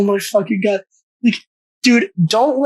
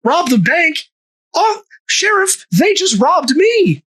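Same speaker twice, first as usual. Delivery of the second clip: mock innocent Logan; 8 semitones higher than usual. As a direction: play the wide-eyed thief, pitched up. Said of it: "he goes".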